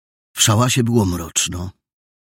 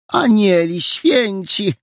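high, slow gasp of dismay; landlady aghast at the bruised boy; shocked exclamation; 14 semitones higher, 5 dB louder, slower than usual. Acted. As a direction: cry out in horror. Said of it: "załamała ręce".

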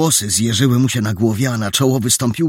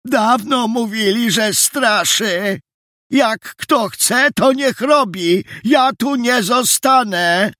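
put-on high, slow old man's call; drawn-out, high-pitched elderly voice; 12 semitones higher, slower than usual. A narrator's reading. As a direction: draw it out like a stooped old man crying out. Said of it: "zawołał stary, przygarbiony człowiek".

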